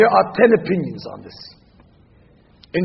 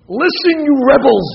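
mock angry rebuke; 4 semitones higher, 5 dB louder than usual. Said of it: "he lost his cool".

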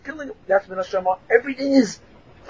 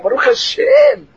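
higher and slow, called out; exclaimed; 11 semitones higher, slower than usual. Real, not acted. Thanking God before the class, higher, slow and called out.

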